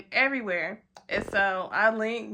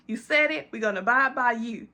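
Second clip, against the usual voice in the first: ordinary talk, nothing marked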